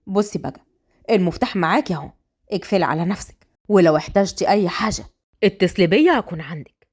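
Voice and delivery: low, practical instructions; helpful young man. Acted.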